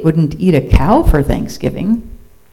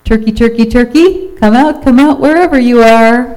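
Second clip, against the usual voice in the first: high, loud sing-out call; 7 semitones higher, 5 dB louder than usual. A narrator's reading. Farmer Jake hunts Turkey in the barn, calling out. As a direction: call out for someone hiding.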